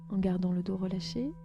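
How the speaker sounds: ordinary delivery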